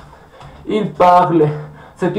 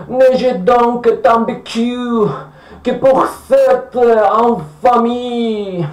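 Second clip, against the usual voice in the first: very slow, stretched tragic verse; hammed-up classical tragedian, syllables drawn out; mock declamation; 3 semitones higher, much slower than usual. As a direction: declaim each word with pompous weight. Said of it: "qui se met à déclamer".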